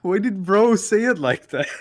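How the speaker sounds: high, loud, amused disbelief; cracking up as you call out a friend; incredulous teasing. Real, not acted. Teasing a friend's odd delivery.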